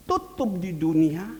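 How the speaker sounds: loud "tutup", punched out; emphatic warning. Acted. Preacher warning the road closes on earth, emphatic. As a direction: ring out the first warning.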